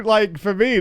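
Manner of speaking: ordinary talk, nothing marked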